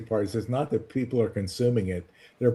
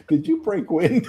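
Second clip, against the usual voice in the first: laughing through the question; giggling mock accusation; 4 semitones higher than usual.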